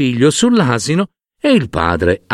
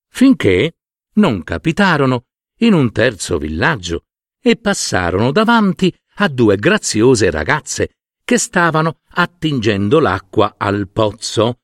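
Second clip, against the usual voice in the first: ordinary delivery, nothing marked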